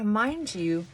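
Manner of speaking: ordinary talk, nothing marked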